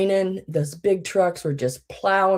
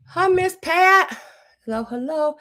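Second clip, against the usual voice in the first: high greeting; 12 semitones higher, 3 dB louder than usual. Real, not acted. Greeting a viewer.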